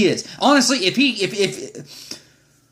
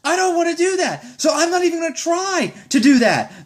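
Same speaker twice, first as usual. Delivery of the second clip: exasperated laughing; 10 semitones higher than usual. Real, not acted. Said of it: "exasperated rant".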